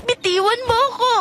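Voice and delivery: high, struggling demand; panicked protest. Acted. Sofia struggles as Luis restrains her, panicked. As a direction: demand release while fighting a grip. Struggles against being silenced.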